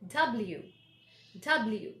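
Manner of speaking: W as "DUB-l-you", first syllable stressed; careful "double-you"; correct W. Shown as a demonstration. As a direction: stress the start of W, glide into "you". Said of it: "You have to stress on the".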